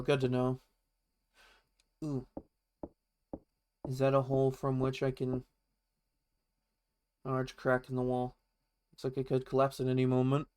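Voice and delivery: ordinary delivery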